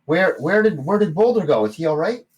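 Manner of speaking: high, loud worried gnome; gnome barkeep. Acted. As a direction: ask after Boulder in alarm. Gnome asks anxiously.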